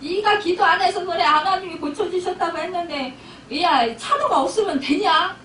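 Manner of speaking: retold telling-off with a touch of temper; mock angry scolding. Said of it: "막 역정을 내시는 거예요".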